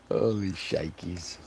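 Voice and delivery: ordinary delivery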